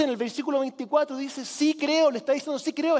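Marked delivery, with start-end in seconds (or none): 1.4-3.0 higher, louder eager cry; eager exclamation. Retold or imitated. Father answering Christ at once, eager exclamation. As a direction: blurt it out at once, earnest. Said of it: "exclamó de inmediato el padre".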